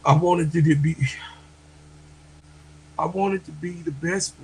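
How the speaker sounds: tearful, heartfelt thanks to the sisters; real crying. Real, not acted. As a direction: push the words out through real tears. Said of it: "Real men cry too".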